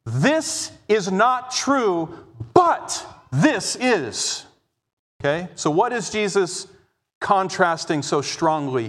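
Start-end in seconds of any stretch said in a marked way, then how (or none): 0.0-4.4 "This" and "but this" drawn out slowly and hit hard; strong contrast stress. Shown as a demonstration. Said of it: "very strongly to us".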